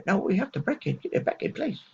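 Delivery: high, strained put-on voice of the doctor; mock doctor voice. The doctor greeting the patient in a high mock voice. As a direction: squeeze the voice up high to play him. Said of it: "he said".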